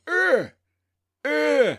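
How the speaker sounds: loud high animal grunts; grunting. Retold or imitated. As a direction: grunt like a mating tortoise.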